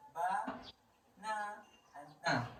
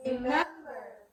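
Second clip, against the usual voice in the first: Remember called out louder and higher; class reads aloud; 5 semitones higher, 6 dB louder, slower than usual. Shown as a demonstration. Teacher prompts class to read, louder. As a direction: read it out together. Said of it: "everybody read".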